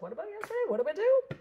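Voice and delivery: high mock kid voice; hammed-up imitation of a student; imitating a boy. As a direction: squeak it out like an eager student. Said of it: "And Andrew's like".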